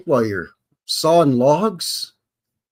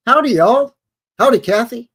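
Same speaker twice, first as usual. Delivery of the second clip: hammed-up cowboy howdy; 9 semitones higher, 7 dB louder than usual. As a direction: greet the chat like a playful cowpoke. Cowboy greeting to chat.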